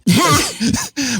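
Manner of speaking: high giggling laugh; burst of laughter. Real, not acted. Laughs at own mistake.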